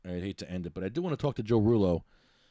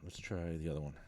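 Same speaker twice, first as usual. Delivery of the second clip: softer, whispered aside to himself; 6 semitones lower, 10 dB quieter, faster than usual. Real, not acted. Host whispering to himself while redialing, quiet whisper. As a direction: whisper it under your breath.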